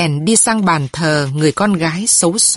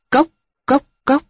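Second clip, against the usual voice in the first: high clipped tok tok tok of a wooden bell; wooden knock imitation; 8 semitones higher than usual. Crier's wooden bell beating, high vocal knocks.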